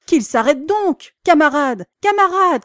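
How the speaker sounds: loud high pleading shout; pleading cry. A narrator's reading. Animals begging the cart horses, loud and high. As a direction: beg the horses to stop. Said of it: "criaient les animaux".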